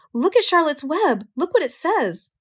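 put-on high-pitched, excited girl's voice; thrilled high exclamation; excited cry. A narrator's reading. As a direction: cry out high, urging everyone to look. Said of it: "cried Fern".

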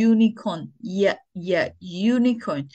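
"unicorn" as "yoonicorn", with "yuh, yuh" isolated; correct pronunciation, sounded out. Shown as a demonstration. Teacher drilling the correct unicorn, Y sound isolated. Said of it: "the correct pronunciation is unicorn".

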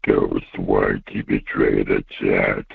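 ordinary talk

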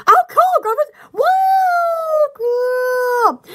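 high excited exclamation. Real, not acted. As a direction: burst out with delight. Excited about groceries.

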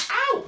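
sudden high, loud "ow"; comic yelp of pretend pain; mock pain yelp. Stretching a balloon for children, hammed-up pained yelp.

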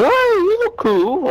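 mock friendly stranger. Mocking strangers who walk up to chat. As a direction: imitate an overeager stranger. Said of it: "Come up to me going".

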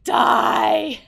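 sudden loud scream of Die; shout. Real, not acted. Player screaming at the game in shock. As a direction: yell it out at full force.

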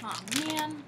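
high letdown sigh; disappointed sigh. Real, not acted. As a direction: sigh in mock dismay. Didn't get blue raspberry.

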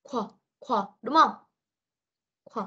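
"Kh" as a short, isolated sound; letter sound demo. Shown as a demonstration. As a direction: sound out "Kh" briefly for a pupil. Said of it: "Và đọc là gì?".

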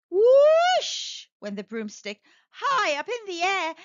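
building wonder; excited. Acted. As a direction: make the broom soar. Broom flies up dramatically.

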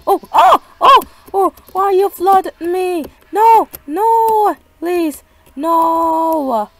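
high, loud panicked begging; frightened cries at the flood; panicked pleading. Real, not acted. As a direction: beg the game to stop.

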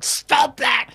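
sudden high, loud cry; full-voiced retold outburst to stop someone; imitated alarmed shout. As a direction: yell it like a nurse stepping in.

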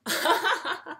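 put-on a short bright laugh for the kids; little show-and-tell giggle; demo laugh. Shown as a demonstration. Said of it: "Can you laugh?".

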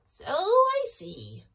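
high loud squawky hen; squawking chicken. Acted. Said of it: "squawked the chicken".

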